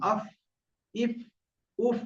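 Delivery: "আফ", "ইফ", "উফ" separately, each ending on f; airy fa. Shown as a demonstration. Teacher has students try fa, "আফ", "ইফ", "উফ". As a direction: let air out through teeth on lip in "আফ", "ইফ", "উফ". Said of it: "উচ্চারণ করে করে দেখেন".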